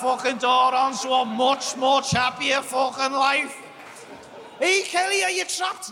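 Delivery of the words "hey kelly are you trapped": mock call out. Mock voice calling to wife.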